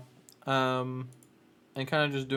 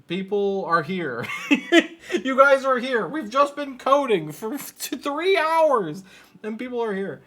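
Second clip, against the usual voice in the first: laughing with delight, higher and louder; 10 semitones higher, 12 dB louder than usual. Real, not acted. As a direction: giggle through it, touched that people showed up. Delighted that viewers are watching.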